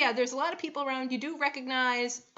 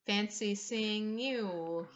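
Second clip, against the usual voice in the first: sly greeting; 4 dB quieter, much slower than usual. Acted. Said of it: "she looks over and you and goes".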